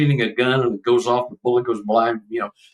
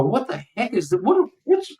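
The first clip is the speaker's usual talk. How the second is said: bewildered; 13 semitones higher than usual. Retold or imitated. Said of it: "I go".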